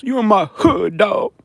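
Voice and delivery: high mocking threat. Streamer mimics one of those comments, mocking high tough guy. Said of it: "It was one of those".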